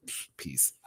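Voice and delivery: breathy scoff, then a whispered peace; dismissive whisper. Retold or imitated. Waving off the guy at checkout, whispered scoff. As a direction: brush him off with a whispered psh. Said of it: "I was like".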